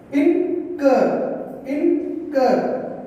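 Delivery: "incur" broken into two parts, in... cur; teacher spacing out "in" and "cur" in "incur"; split into syllables. Shown as a demonstration. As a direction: say "incur" syllable by syllable, with a gap after "in". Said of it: "pronounce this word with the two syllables".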